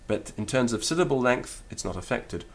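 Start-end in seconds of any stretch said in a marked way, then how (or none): none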